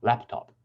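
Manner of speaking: "laptop" stressed on the first syllable, LAP-top. Shown as a demonstration. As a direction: hit LAP in "laptop", let top fall. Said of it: "it's on the first syllable".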